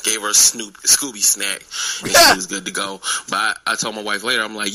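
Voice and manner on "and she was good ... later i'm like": ordinary delivery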